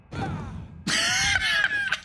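high-pitched laughing fit; real cackling laugh; helpless laughter. Real, not acted. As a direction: burst out laughing, long and high. Cracking up at the game.

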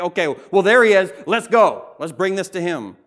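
loud, high, urgent rallying call; rally everyone loudly, voice lifted; eager crowd. Retold or imitated. Crowd spots Jesus and urges each other over, eager and loud.

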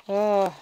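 loud exasperated "ugh"; frustrated groan. Real, not acted. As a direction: groan out loud, torn by temptation.

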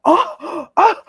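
high, gasping imitation of an affronted reaction; mock shock. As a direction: act scandalized with gasped ohs. Imitating an offended present-giver.

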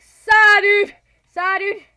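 loud, high hollered catchphrase; excited shout. Real, not acted. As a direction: holler it out to the game. Calling out excited catchphrase in game.

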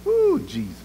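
a high, soft moan of feeling; high, soft sympathetic ooh; high sighing ooh. Real, not acted. Preacher's high exclaim.

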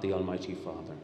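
ordinary talk, nothing marked

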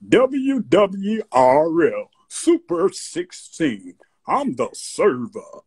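slow, drawn-out radio station call; Hank Spann's deep voice. Retold or imitated. Fan imitates his other mentor's station call, slow announcer voice. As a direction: imitate a deep-voiced DJ, stretching each word. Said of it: "he had a real deep voice".

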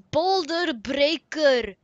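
loud, slow, drawn-out proclamation; big stretched-out game announcer; grand item announcement. Acted. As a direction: announce the weapon name with mock grandeur. Announcing a weapon just received.

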